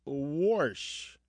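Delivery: "wash" said as "worsh", R before the sh; warsh with an R. Retold or imitated. Host echoing the caller's mother, intrusive-R pronunciation. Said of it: "your mother says".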